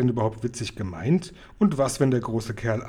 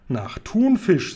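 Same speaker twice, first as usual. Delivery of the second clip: drawn-out, higher, louder; giant answer; 7 semitones higher, 5 dB louder, much slower than usual. Acted. Giant telling Sophiechen the answer, slow and grand. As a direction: savour the word slowly.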